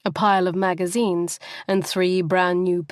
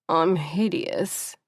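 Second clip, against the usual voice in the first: slow, miserable moan into a pillow; slow self-pitying moan; much slower than usual. A narrator's reading. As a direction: drag it out, muffled and sorry for herself. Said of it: "she moaned into her pillow".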